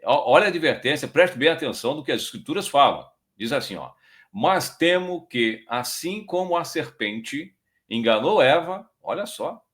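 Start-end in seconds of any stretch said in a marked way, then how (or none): 4.3-8.9 slow, weighty reading of "Mas temo que"; each word of "Mas temo que" drawn out slowly; slow scripture reading. Shown as a demonstration. Preacher reading the verse to the congregation, slow and weighty. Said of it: "olha como é que Paulo vai no profundo".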